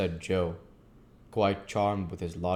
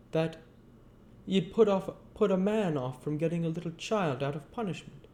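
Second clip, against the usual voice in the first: higher-pitched Joe, earnest and homely; Joe's voice; 7 semitones higher than usual. Acted. Joe talking gently to old Pip, in a higher voice. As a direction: pitch up into Joe, a plain simple man.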